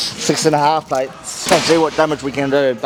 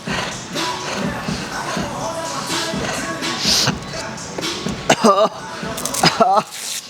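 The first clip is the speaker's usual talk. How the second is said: high wheezy airless laugh; breathless wheezing laugh; 12 semitones higher, 4 dB quieter than usual. Real, not acted. Reaction to smelling salts.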